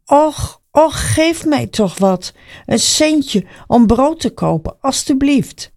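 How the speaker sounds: high, drawn-out plea for alms; beggar calling out. A narrator's reading. Blind beggar calls to passers-by for a coin, pleading. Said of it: "hij begon te roepen".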